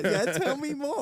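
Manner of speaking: put-on high, gushing girly voice; hammed-up schoolgirl gush; gossiping teenage girl. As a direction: mimic a rapt teenage girl hanging on every word. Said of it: "like two little teenage girls".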